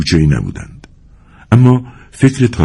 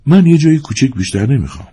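eager, hurried plea at higher pitch; 9 semitones higher than usual. A narrator's reading. Ardanif turns quickly to the young woman, hurried. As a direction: say it in haste, voice lifted. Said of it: "با شتاب رو به زن زیبا برگشت و گفت".

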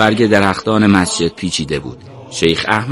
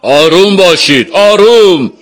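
raised, commanding voice over a crowd; loud calming call; 8 semitones higher, 5 dB louder than usual. A narrator's reading. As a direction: call out, higher on the repeat. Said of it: "با صدای بلند گفت".

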